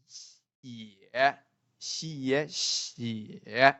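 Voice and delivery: "Xie" drawn out, dipping then rising; third tone. Shown as a demonstration. Teacher drills third tone on xie, dipping syllable. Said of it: "The third tone".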